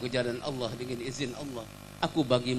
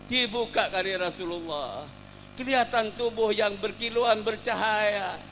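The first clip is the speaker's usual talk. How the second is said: raised-pitch, impassioned storytelling; emotional sermon narration; 7 semitones higher, slower than usual. A narrator's reading. Preacher tells of Ukasyah embracing the Prophet, with emotion. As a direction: build the climax with rising feeling.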